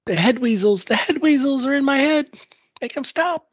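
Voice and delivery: high, frantic babble; high-pitched crazed voice. Acted. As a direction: squeal it like you've lost your mind. Player voicing crazed character.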